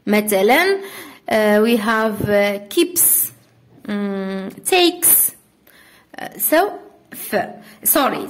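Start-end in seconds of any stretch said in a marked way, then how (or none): 2.7-3.3 "keeps" ending in a crisp unvoiced s; final s sound. Shown as a demonstration. Teacher giving an example of final S, s sound. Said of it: "So here تنطق س". 4.7-5.3 "takes" ending in an s, not a z; final s sound. Shown as a demonstration. Teacher giving a second example, final s sound. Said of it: "So here تنطق س".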